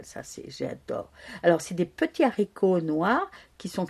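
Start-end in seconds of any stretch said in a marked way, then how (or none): 0.0-1.1 whispered aside. Real, not acted. Aside about loving the dish.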